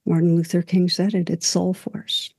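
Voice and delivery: ordinary talk, nothing marked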